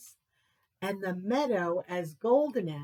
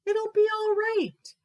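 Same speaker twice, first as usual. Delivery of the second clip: high, loud comforting voice; reassuring; 13 semitones higher, 5 dB louder than usual. Acted. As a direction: comfort him brightly. Friends comfort Bear.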